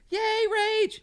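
high mock cheer; high-pitched pretend cheer for a coworker. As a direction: cheer like waiting for baby news. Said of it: "We're all, like".

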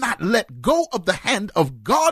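high, rising preacher's cry; fired-up preaching voice. Real, not acted. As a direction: preach it full force, building. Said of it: "put my preaching voice on".

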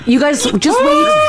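high, slow shout over the chaos. Real, not acted. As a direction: call out to hold everyone back. Host in chaos after the chip.

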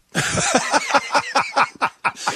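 a breathy high laugh; high airy laugh. Real, not acted. Laughs at son's bedtime line.

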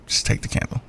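soft whispered urging. Retold or imitated. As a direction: hush it like a secret insistence. Retelling the friend's pushy gift.

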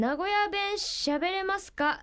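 slow, careful letter reading; reading a letter aloud. Real, not acted. Host reads a listener's card to the guest, slowly. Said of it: "お便り届いてんで読んでいいですか？".

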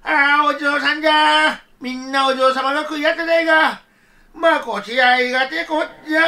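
put-on overjoyed old grandfather; mock tearful joy at the jizo; joyful old man.

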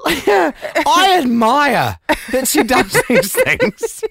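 slow and high, squeezed out through laughter; fighting the giggles to finish the thought; laughing. Real, not acted. Host admiring his wife while laughing helplessly.

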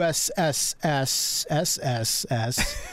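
slow hissing S held on; drawn-out s like a snake. Real, not acted. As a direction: stretch the S out like a snake. Drags out the letter S.

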